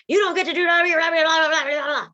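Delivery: shrill-free mock lecture, high and loud; mock heady lecturer. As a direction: parody someone stuck in their head lecturing.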